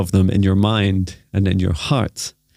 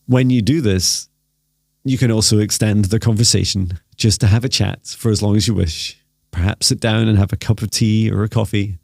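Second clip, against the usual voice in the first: guided meditation. Acted. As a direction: gently lead them into stillness.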